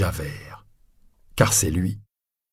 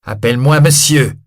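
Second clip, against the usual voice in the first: loud, higher, sharp order; cutting command; 6 semitones higher, 9 dB louder than usual. Acted. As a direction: cut him off hard. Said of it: "Javert le coupe".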